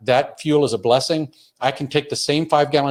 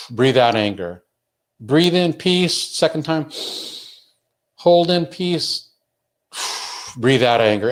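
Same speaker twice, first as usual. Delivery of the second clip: slow breathing exercise; 3 semitones higher, slower than usual. Shown as a demonstration. Parent showing the child a calm breathing routine, slow and soothing. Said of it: "just do this".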